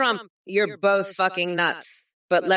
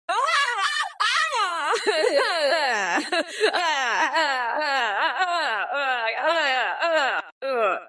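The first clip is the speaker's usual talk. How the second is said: cackling laugh; 6 semitones higher than usual. Acted. Mock Kamala cackling between insults.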